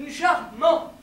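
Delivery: loud, firmly stressed CHARMANT; emphatic repeat. Acted. Insisting the accent is charming, loud repeat. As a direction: hit both syllables of Charmant loudly.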